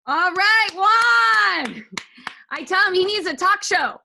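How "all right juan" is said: loud, drawn-out cheer for a performer; host hyping a student's act. Real, not acted. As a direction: whoop the name proudly, stretched out.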